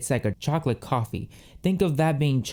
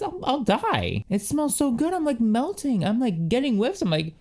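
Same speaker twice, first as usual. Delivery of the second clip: much higher, giddy gushing; gushing squeak; 10 semitones higher than usual. Real, not acted. Swooning over the coffee scent, she gushes much higher. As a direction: gush like you're swooning.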